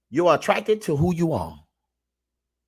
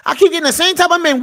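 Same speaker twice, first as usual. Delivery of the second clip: high, fast, mock-tearful whine; a hint of a complaining woman; mock whiny woman; 10 semitones higher, 4 dB louder, faster than usual. Host mimicking a woman who dates bad men, mock whine. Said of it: "mimicking woman's complaint".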